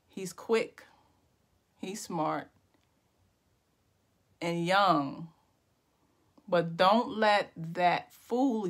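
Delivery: slow, drawn-out poem recital with long pauses; spoken-word poetry. Pretend. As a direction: make up a poem line by line, savouring each phrase. Improvised poem about the Ravens.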